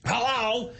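high, impatient mock-yelled hello; impatient dad yelling. Dad talks over the car's beep, mock yell. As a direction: imitate a dad hollering at the car. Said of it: "he's yelling before the beep".

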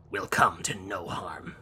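slow, breathy sorcerer's whisper; eerie whisper. Acted. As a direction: hush it like a sinister fortune teller. Necromancer speaks to Yu.

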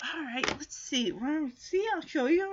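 soft muttering to himself; quietly confident. Acted. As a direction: size up the job under your breath. Voicing Linus quietly sizing up task.

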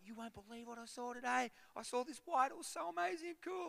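high, gushing rush of excitement; buzzing phone call to his wife; excited. Retold or imitated. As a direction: bubble over telling her the news. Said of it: "I'm like".

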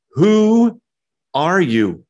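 sarcastic challenge, high, loud, drawn-out WHO. Acted. Crowd questioning Jesus in verse twenty-five, sarcastic. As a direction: throw the question at him, pausing after WHO. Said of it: "this is sarcastic".

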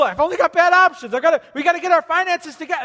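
high, loud and rushed worry; frantic money panic; panicked inner voice. Retold or imitated. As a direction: blurt it out fast like a panicking debtor. Voicing a tempted believer.

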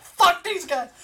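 high, loud furious yell; retelling an angry coach's outburst. Retold or imitated. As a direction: snap at them in a rage. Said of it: "he gets all angry".

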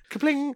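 high pinged kapling; playful high bleep through a laugh; point-scoring noise. Pretend. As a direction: chime it like a game score. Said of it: "It's my copyrighted sco-, point scoring noise".

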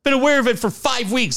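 usual speaking voice

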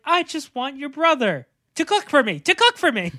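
lightly voiced rich man; imitating character. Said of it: "And he's like".

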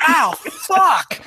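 sharp, high yelp of pain. Acted. Player voicing Reggie scratched by cat, high pained yelp. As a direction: yelp as the claws dig in. Cat claws down his back.

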